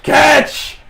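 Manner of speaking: loud, high-pitched holler; shouted call. Acted. Greer tossing keys to a friend, high shouted call. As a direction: yell it high as you toss something. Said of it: "And he goes".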